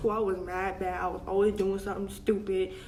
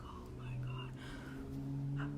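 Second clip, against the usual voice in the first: dread whisper; 9 semitones lower, 14 dB quieter than usual. Retold or imitated. Dread before the confession.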